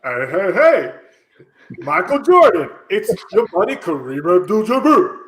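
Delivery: low cartoon bear voice; Yogi Bear voice. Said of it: "sounds like Yogi Bear".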